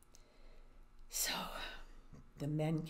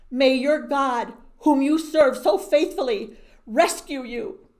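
king sending his friend to the lions with a prayer; earnest king's blessing; 6 semitones higher, 15 dB louder than usual. Acted.

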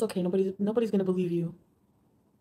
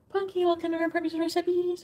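higher, brighter greeting voice; excited welcome; 9 semitones higher, 3 dB louder than usual. Real, not acted. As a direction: welcome the viewer warmly up high. Stream welcome to viewer.

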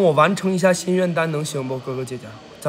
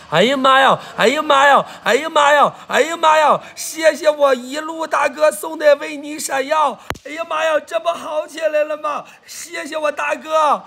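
high, repeated whoops of delight; over-the-moon livestream hype; excited gift shout-out; 8 semitones higher, 4 dB louder than usual. Real, not acted. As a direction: holler thanks at the top of your range. Reacting to a big gift.

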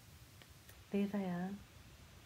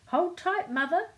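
higher, louder urgent call; 11 semitones higher, 7 dB louder than usual. A narrator's reading. Little man to his mother as the kite lifts, calling out. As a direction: call out a warning. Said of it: "he called".

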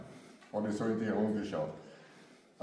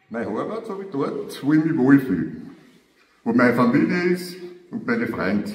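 louder, proud, ringing recital; loud poem climax; 2 semitones higher, 12 dB louder than usual. Acted. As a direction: declaim your love for the hometown. (lauter, feierlicher Gedichtvortrag)

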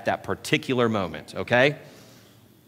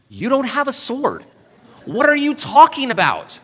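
much higher, baffled doubter voice; incredulous skeptic; 10 semitones higher than usual. Pretend. A skeptic challenging David about his missing sword, high incredulous voice. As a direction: play the doubter who can't believe it.

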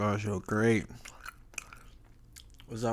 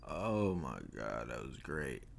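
low, slow, soft satisfied sigh; 8 semitones lower, 6 dB quieter, slower than usual. Real, not acted. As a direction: savor every word slowly. Satisfied after eating, slowed.

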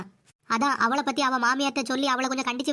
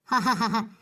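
gloating laugh. Acted. Daughter-in-law gloating over the beaten mother-in-law, gleeful laugh. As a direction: laugh with glee at her fall.

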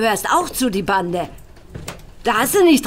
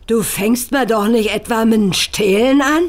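ordinary talk, nothing marked

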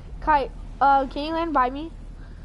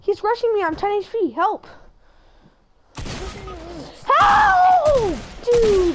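panicked shouting; 8 semitones higher, 5 dB louder than usual. Real, not acted. Getting rushed, calling teammate.

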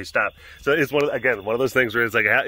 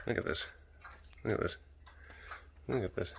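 much softer, near whisper; hushed; 3 semitones lower, 18 dB quieter, faster than usual. Real, not acted. Owner filming her cat in bed, hushed. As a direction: hush so the cat stays asleep.

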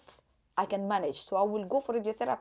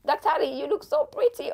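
higher and louder, starstruck; gushing compliment; 6 semitones higher, 5 dB louder than usual. Retold or imitated. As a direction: gush over the pretty doctor. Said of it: "Aish, I was like".

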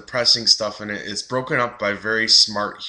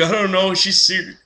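put-on high, louder dopey kid voice, baffled; clueless goofy boyfriend; 10 semitones higher, 4 dB louder than usual. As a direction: play the dim boyfriend who doesn't get it. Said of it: "he's like".

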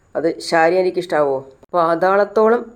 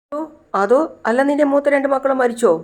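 much higher, put-on questioning voice; acted taunting question; 8 semitones higher than usual. Disturbed daughter asking her crying mother about her children, taunting. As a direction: push the question up high, hammed-up.

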